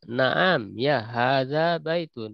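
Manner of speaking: slow, falling answer; slow answer model. Shown as a demonstration. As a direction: answer calmly and slowly, letting it fall. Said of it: "Nah, di sini ditanya".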